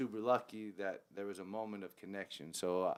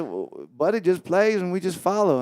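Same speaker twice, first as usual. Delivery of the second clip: excited band mate; 9 semitones higher, 15 dB louder than usual. Retold or imitated. Keys player laughs about no key.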